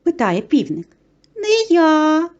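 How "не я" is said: high-pitched refusing mouse; mouse calling out. A narrator's reading. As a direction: call back a drawn-out refusal. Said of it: "гукає Круть".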